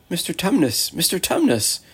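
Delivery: high, pleading cry of the name; greatly distressed. A narrator's reading. Girl calling to her weeping faun friend, distressed. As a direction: call out in distress, pitch lifted high. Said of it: "said Lucy in great distress".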